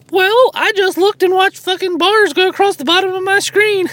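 very high squeaky cartoon voice; Mickey Mouse voice. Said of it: "why the fuck do I sound like Mickey Mouse".

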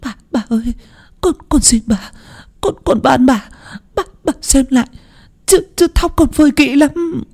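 put-on high, trembling plea of a beaten servant girl; pleading. A narrator's reading. Servant girl begging her mistress not to beat her, pleading high. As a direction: beg the mistress desperately, voice pitched high. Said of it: "bấy giờ mới van xin".

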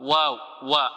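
normal talking voice

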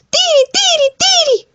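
very high piercing bird call; mother bird's warning. Acted. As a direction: cry out like a mother bird guarding her nest. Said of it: "le avisaba la mamá Tero".

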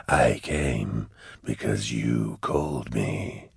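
low, slow demon purr; deep voice, every word drawn out; Cherubael. A narrator's reading. Cherubael answers the man who summoned him, slow and eerie.

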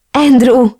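loud, high happy call. A narrator's reading. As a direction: hail him while running over. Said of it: "Szaladt oda Elena is".

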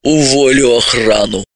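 low-pitched promise without malice; easygoing low vow to fire them; mild, unmalicious threat. A narrator's reading. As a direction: threaten it mildly, voice dropped. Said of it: "беззлобно пообещала".

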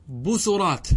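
بسرات said "busurāt", u after the s; damma on the sin. Shown as a demonstration. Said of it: "بالضم". (بسرات بضم السين)

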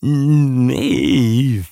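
put-on drawn-out high animal bark with an old quack; quacking, aged bark. A narrator's reading. Strange beast facing the man on the beach, quacking senile bark. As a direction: bark it like a croaky old duck. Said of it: "bellte es irgendwie quakend und greisenhaft".

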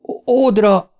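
high, stammered cry; contained narrated scream; screamed name. A narrator's reading. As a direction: cry out in shock, catching on the first sound. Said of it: "sikoltotta Bill".

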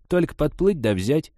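high, insistent coaxing; pushy. Acted. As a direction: make it sound easy, pressing hard. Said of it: "напористо продолжала Степановна".

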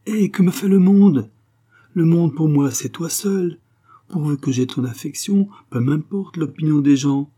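high-pitched, ardent lover's protest; passionate defiance. A narrator's reading. Angèle passionately defies public opinion. (protestation passionnée, voix haute)

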